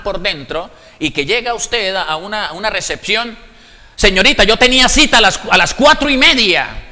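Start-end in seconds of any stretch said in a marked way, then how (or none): 4.0-6.9 loud, fast, pushy complaint; rude demand. Acted. To the receptionist, an impatient patient's loud rude demand. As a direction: snap at the receptionist like you're owed.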